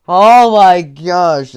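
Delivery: slow, loud, stretched out; loud relieved gasp. Real, not acted. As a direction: breathe out the shock.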